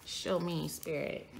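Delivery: soft low call to the spirit; hushed plea to the spirit. Real, not acted. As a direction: ask the spirit under your breath. Asking the cards' spirit.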